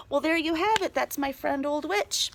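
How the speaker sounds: ordinary delivery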